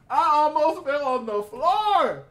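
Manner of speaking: high, slow, loud and drawn out; dramatic excitement. Real, not acted. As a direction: stretch it out in amazed delight. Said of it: "repeat said bigger".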